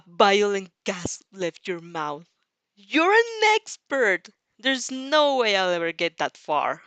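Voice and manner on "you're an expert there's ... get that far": higher, louder, slow awed cry; amazed exclaim. Acted. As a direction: gush in disbelief. Gasps at score of two hundred.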